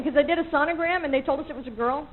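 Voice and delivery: ordinary delivery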